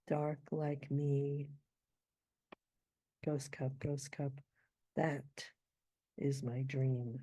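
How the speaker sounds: "Dark like me", pause, "that", pause, "is my dream", slowly; ghost rests. Shown as a demonstration. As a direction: let silent beats hang before "is my dream". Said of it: "builds up the suspense".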